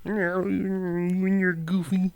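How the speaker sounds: slow, high froggy puppet voice; slow Kermit-style voice, fighting a laugh. Acted. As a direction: drag it out like a cartoon frog. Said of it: "That's a taxing voice".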